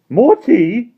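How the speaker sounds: put-on high, loud jolly old man; Mister Wig's voice. A narrator's reading. Mister Wig welcoming the children, high and jolly. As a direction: beam at the children like a merry host.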